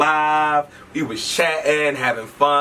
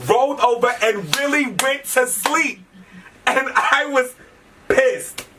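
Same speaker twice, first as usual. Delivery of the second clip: high, drawn-out storytelling outrage; retold indignation; 11 semitones higher, slower than usual. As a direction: pitch it way up, stretching each word in disbelief. Indignant climax of her story.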